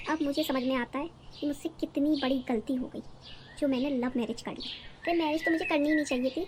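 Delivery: usual speaking voice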